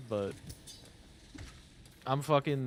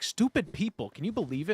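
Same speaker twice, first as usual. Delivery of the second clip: higher, scoffing entitled voice; mock Karen griping; 5 semitones higher than usual. Karen griping to the cashier while being rung up, mock scoffing. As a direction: roll your eyes as the Karen.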